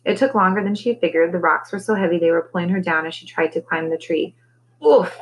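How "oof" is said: loud, high oof of effort; high burst of exertion; groaned oof. A narrator's reading. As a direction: grunt as if flopping down under a load. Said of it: "she groaned as she flopped".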